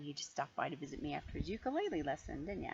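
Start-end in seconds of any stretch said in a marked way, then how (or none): none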